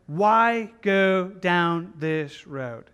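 very slow, drawn-out challenge; slow, weighty appeal; emphatic plea. Acted. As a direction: stretch out each word of the question. Pressing the rhetorical question home.

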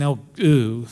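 a mock "ooh" of disgust, pulling away; disgusted recoil. Preacher acting out disgust at the leper. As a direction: imitate someone recoiling from a leper. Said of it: "He didn't say to this man".

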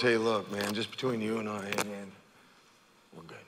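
quiet, secretive murmur; hushed aside. Retold or imitated. Not the healed leper, a quiet private thanks. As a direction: keep it just between us. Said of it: "This isn't one of those".

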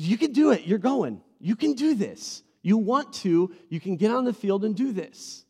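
loud, high, forceful encouragement; insistent pep talk. Retold or imitated. Dad to crying daughter before the game, loudly insistent. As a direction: press each line like an exasperated coach.

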